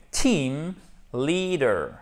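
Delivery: "Team leader" split in two, each ee held long; "Team" rising, "leader" falling, both with long ee; long I sounds. Shown as a demonstration. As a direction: separate and stretch "Team" and "leader". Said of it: "Là, vous avez un i long".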